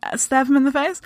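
high, disbelieving "Really?"; play-acted disbelief, starting high and easing down; mock incredulity. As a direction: question it as a baffled onlooker. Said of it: "everyone else in the world are like".

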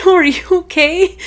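high, loud imitation of a concerned kid; insistent daughter. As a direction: press the question like a worried child. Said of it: "She's like".

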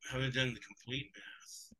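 ordinary talk

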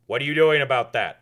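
loud, demanding booming question; Tim Misney voice. Retold or imitated. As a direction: press him, big voice. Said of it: "I used to be able to do his voice".

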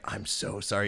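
quiet, acted remorse; retold sheepish apology. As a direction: drop the volume and apologize contritely. Apologizing to friend after the fight.

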